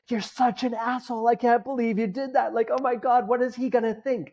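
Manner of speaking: high, frantic inner-critic voice, a mock scream; panicked inner critic. Inner voice berating him for taking all the chai, high and frantic. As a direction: play the anxious voice in his head. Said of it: "this voice in my head is screaming now".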